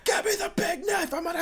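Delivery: very high, frantic demand; hammed-up melodramatic rant; mock hysterical yelling. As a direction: shriek for the knife. Acting out the tirade.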